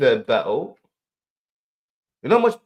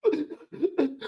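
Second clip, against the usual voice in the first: put-on [name] voice on the phone; imitating [name]; 5 semitones higher than usual. Retelling [name]'s phone call, lightly imitated with a laugh. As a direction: play [name] backing out of the battle, half laughing. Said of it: "And [name] phone, yo".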